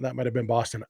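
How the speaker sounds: normal talking voice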